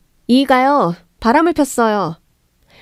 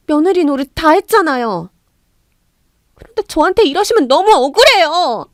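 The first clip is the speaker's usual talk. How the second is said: higher, louder, pleading outburst; daughter-in-law bursts out at her mother-in-law, aggrieved; pent-up anger bursting; 6 semitones higher, 4 dB louder than usual. Acted. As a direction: let years of grievance spill out. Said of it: "울분을 터뜨렸습니다".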